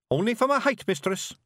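high, cheeky little pixie voice. A narrator's reading. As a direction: pipe up like a tiny Scottish pixie. Feegle pixie answering the witch.

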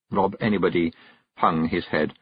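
plain reading voice

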